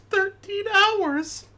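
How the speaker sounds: high, slow, laughing incredulity; laughing disbelief. Real, not acted. Disbelief at flight length.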